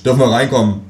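a hint of a pushy policeman; police officer. Police at the door asking to come in, lightly voiced officer.

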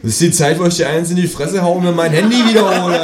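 high, rising comic rant; hammed-up exasperated shouting; angry threat. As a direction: build to a loud, outraged threat. Acted threat over stolen phone.

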